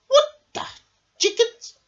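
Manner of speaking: high, loud gasping bees; shocked little bees. Acted. Little bees gasp on learning Norman is a bear. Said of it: "gasped all the little bees".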